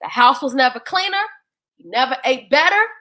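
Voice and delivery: ordinary delivery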